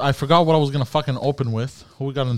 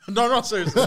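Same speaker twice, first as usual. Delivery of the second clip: laughing; 8 semitones higher, 3 dB quieter than usual. Real, not acted. Laughing while protesting.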